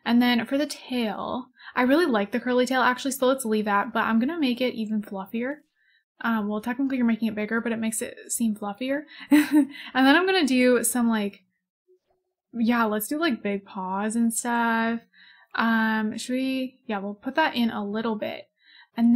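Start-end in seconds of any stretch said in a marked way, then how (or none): none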